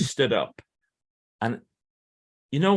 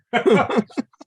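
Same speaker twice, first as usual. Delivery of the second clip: laugh; 13 semitones higher, 7 dB louder than usual. Real, not acted. Laughs while searching for his book.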